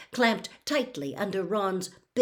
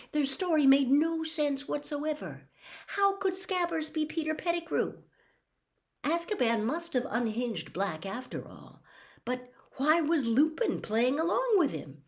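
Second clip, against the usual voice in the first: higher-pitched, incredulous questioning; incredulous thoughts; 8 semitones higher than usual. Acted. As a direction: voice disbelief, pitched well up. Harry's incredulous thoughts, voiced.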